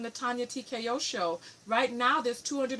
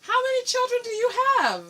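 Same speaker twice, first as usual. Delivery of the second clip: rushed, higher and louder disbelief; shocked question; 10 semitones higher, 7 dB louder, faster than usual. Real, not acted. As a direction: blurt it out in shock. Host shocked by caller's answer.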